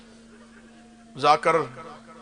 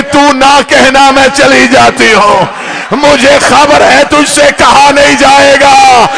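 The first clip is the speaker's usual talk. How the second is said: loud, slow, high keening; loud slow wailing mother's lament; crying; 6 semitones higher, 15 dB louder, slower than usual. Acted. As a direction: wail the mother's words through tears. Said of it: "रो के कहती".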